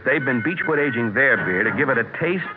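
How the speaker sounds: ordinary delivery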